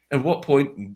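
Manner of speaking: imitating your own nervous squeak; squeaky voice. Nervous attendee blurting a question to the speaker, squeaky voice. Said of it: "with my squeaky kind of voice".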